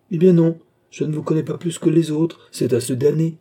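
high, loud outburst of frustration; vexed, emphatic complaint; exasperated. Acted. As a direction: throw up your hands vocally. Doctor exasperated at women's mysteries.

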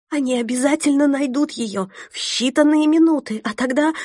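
high, loud frightened outcry; rising to a scream. Acted. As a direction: voice climbing into a terrified cry. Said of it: "голос Элен поднялся до крика".

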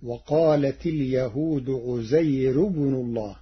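عزير said without nunation, light thin ra; Warsh reading of عزير. Shown as a demonstration. Teacher showing the Warsh reading, عزير without nunation. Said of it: "فتصير".

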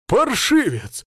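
high, loud angry cry; shouting. A narrator's reading. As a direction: shout after a fleeing boy. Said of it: "крикнул вслед".

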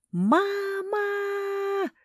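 very high drawn-out wail; piercing, long-held shriek; wailing scream. A narrator's reading. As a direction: cry out for mama in horror. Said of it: "раздался вопль на весь Бобриный утёс".

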